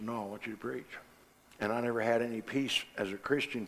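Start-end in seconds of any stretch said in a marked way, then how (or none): 0.0-1.0 quiet firm voice of God. Acted. The Lord answers again.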